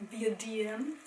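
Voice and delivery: D M said in an odd put-on accent; playfully accented D M. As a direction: give D M a funny accent. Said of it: "not sure why D M needs that particular accent".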